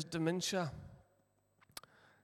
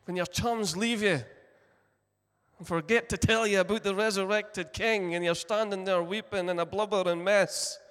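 louder, higher preaching push; impassioned preaching; 4 semitones higher, 7 dB louder than usual. Acted. Preacher urging grieving listeners, loud and fervent. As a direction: drive it home to the congregation. Preacher building to full force.

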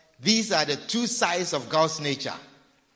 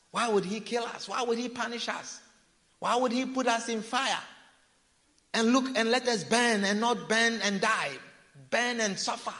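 mocking backsliders; 6 semitones higher than usual. Voicing backsliders' argument.

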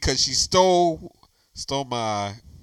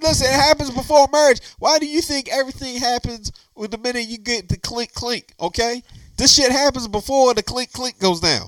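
heated rant; 8 semitones higher than usual. Real, not acted. Arguing hoodie theft before marriage.

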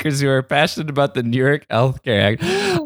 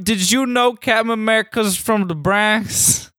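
put-on New York accent; 8 semitones higher than usual. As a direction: call out like a street New Yorker. Mock New Yorker voice.

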